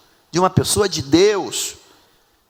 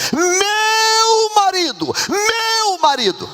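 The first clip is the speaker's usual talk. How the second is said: very high, long-held "meu"; adoring cry; much slower than usual. Acted. Preacher voicing the woman crying to the prophet, adoring cry. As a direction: wail it lovingly, stretching the first word. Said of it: "o clamor dela é lindo".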